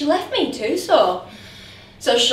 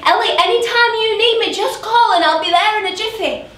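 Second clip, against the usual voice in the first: fond father quoted; 7 semitones higher, 4 dB louder than usual. Retold or imitated. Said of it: "he said to me".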